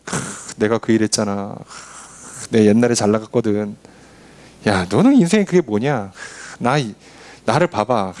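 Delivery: low-voiced, puffed-up old-timer act; arrogant braggart. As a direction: brag about your glory days and dismiss the young, mostly low. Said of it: "어리석고 교만한 사람들은 뭐라 그래요".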